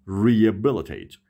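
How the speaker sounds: Rehabilitate said slowly as "ree-e-bilitate"; H dropped. Shown as a demonstration. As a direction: model Rehabilitate slowly with a silent H. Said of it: "یعنی اچ تلفظ نمیشه". (تلفظ آهستهٔ Rehabilitate بدون صدای «ه»)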